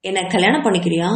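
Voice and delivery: ordinary delivery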